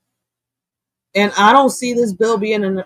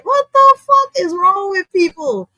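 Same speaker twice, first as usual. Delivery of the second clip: high squeaky what the fuck; incredulous outburst; 11 semitones higher than usual. Real, not acted. Streamer reacts to a crude chat joke, incredulous outburst. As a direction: disbelief pushed way up in pitch.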